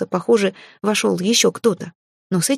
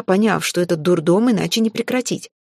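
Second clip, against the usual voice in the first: ordinary delivery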